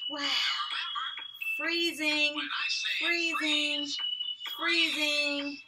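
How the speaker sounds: put-on high, bright sing-song kids' dance caller; upbeat movement leader. Teacher calling dance moves to kids, bouncy and high. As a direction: pump the kids up as you call the moves.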